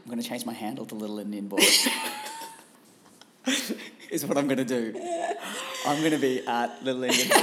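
chuckling through the words; laughing. Real, not acted. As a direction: laugh while you say it. Laughing reply.

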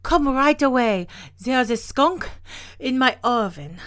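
high, loud plea in broken phrases; out of breath. A narrator's reading. Old woman who dashed down the road, out of breath. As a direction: puff it out between gulps of air. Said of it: "she cried out of breath".

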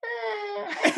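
high-pitched noncommittal "eh"; doubtful grunt. Retold or imitated. Customer gives the actor his verdict on the movie, squeaky "eh". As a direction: imitate a squeaky, unimpressed little noise.

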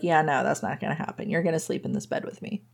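fast, soft, half-whispered; quiet insistence. Retold or imitated. Rosie telling Lucas he will share her bed, fast and half-whispered. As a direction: hush it quickly, not up for debate. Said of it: "But Rosie's like".

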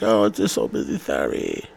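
mocking imitation of her lame apology; mock-apologetic texter; lame excuse. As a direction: trail down into a feeble, mocking sorry. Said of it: "she texted me that lame ass".

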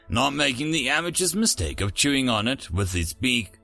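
plain reading voice

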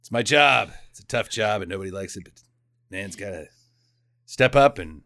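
mock tough hero. [name] says goodbye to little girl, low mock stoicism. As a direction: play a stoic working man, low and understated. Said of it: "uh, he's like".

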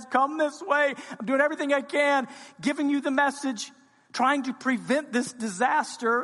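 high, loud, pleading call; wisdom shouting. Acted. Wisdom in the streets begging people to turn back, shouted. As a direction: cry out to the crowd, begging them to turn. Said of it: "begs and shouts and calls and says".

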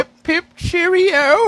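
high, slow hammed-up upper-class English accent; exaggerated English accent; posh English accent. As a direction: ham up a stuffy English gent. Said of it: "That's Southern England".

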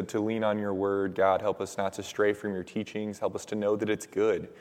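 normal talking voice

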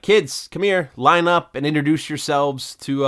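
high, loud summons; calling kids over. Retold or imitated. Acts Steve calling the kids over.

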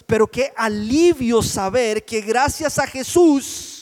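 loud, impassioned preacher; full-force preaching. Real, not acted. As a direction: build high and loud toward the relief.

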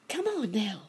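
high-pitched urgent cricket; sudden high cricket cry. A narrator's reading. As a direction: call to a friend, pitched well up. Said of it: "suddenly cried the cricket".

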